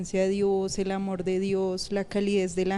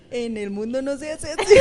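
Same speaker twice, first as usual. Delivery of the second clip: higher, louder, through laughter; laughing; 4 semitones higher, 4 dB louder than usual. Real, not acted. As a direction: laugh as you protest. Habla riendo del choque. (entre risas, más agudo y fuerte)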